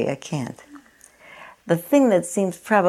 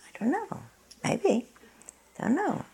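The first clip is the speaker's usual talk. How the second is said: much higher and softer "I don't know", "Maybe"; gentle not-knowing; 12 semitones higher, 8 dB quieter than usual. Pretend. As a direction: let go of having an answer, lightly. Voicing the Zen master's answer.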